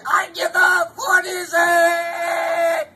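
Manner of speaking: loud drawn-out shout; parents shouting. Retold or imitated. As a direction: bellow it slowly over the crib. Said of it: "leaned over the crib, and said".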